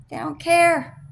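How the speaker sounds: high, loud and bitter; angry outburst. Real, not acted. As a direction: spit it out with real resentment. Angry rant about the friend.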